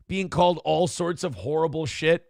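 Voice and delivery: ordinary talk, nothing marked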